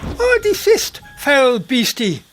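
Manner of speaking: high, loud mock-heroic command; heroic shout. Connor shouting down the monster to protect Doris. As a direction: bellow it like a knight facing a dragon. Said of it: "he shouted".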